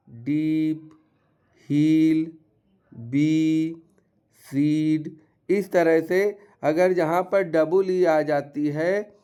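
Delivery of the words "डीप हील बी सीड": डीप, हील, बी, सीड with lengthened ee; long ee. Shown as a demonstration. Teacher reading double-e examples, long ee in डीप, हील, बी, सीड. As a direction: stretch each vowel in डीप, हील, बी, सीड. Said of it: "वहाँ पर उच्चारण थोड़ा लंबा".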